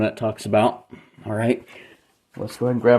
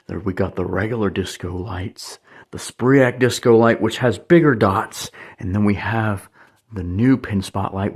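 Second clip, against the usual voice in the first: ordinary delivery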